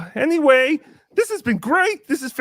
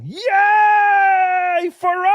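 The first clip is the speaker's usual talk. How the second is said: mock cheer; 7 semitones higher, 4 dB louder, much slower than usual. Cheering the new goal.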